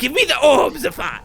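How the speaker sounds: insistent cry, dropping back on the name; sudden demand. Acted. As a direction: burst out demanding it. Character demands the orb.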